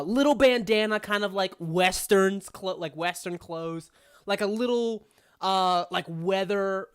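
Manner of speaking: usual speaking voice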